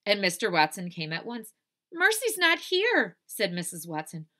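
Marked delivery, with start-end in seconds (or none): 1.9-3.2 high, slow, loud alarm. Acted. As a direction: wail that a pet is missing. Alarmed wife, pig missing.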